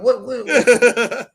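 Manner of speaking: slow, drawn-out mock bewilderment; baffled what. Cohost retelling watching wrestlers' eyes, slow baffled what. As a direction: stretch each what, puzzled.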